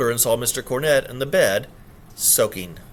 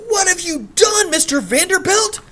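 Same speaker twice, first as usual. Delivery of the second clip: put-on country bumpkin accent; 7 dB louder than usual. Kitchen girl accusing the gentleman, in a loud high country accent. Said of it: "threw a little extra bumpkin into her country accent".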